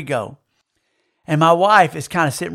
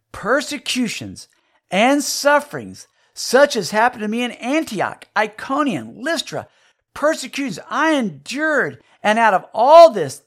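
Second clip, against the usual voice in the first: scripture reading; 6 semitones higher than usual. Acted. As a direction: read the verse out with weight. Reading scripture aloud to congregation.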